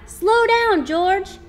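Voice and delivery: high loud warning yell. Acted. Friend yells at George to slow down, loud. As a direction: shout a warning to the driver. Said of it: "yelled his friend".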